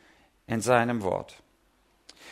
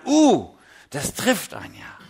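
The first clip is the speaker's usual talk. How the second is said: surprised realization; 6 semitones higher, 5 dB louder than usual. Retold or imitated. Struck by sermon sentence.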